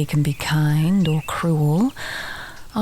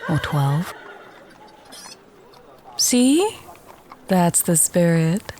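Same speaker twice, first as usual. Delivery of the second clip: normal talking voice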